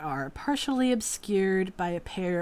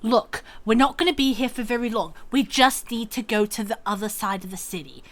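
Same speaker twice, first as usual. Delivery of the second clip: fast, louder huffy explanation; huffy and hurried; 4 semitones higher, 7 dB louder, faster than usual. Acted. Grian huffily tells the man they're leaving now. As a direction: rush through it impatiently. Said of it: "Grian just huffs and says".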